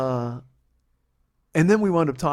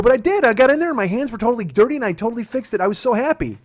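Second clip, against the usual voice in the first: high, loud aggrieved insistence; indignant protest; 7 semitones higher, 4 dB louder than usual. Retold or imitated. Husband defending his repair to wife, indignant protest. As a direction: insist like you're not being believed.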